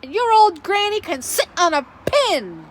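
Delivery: angry pig shouting an insult at the wolf; pig yelling. Acted. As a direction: hurl the insult at him. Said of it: "the third little pig yelled".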